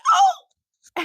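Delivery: shrieking oh of pain; pained yelp. Retold or imitated. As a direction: cry out at the paper cut. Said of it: "And I just went".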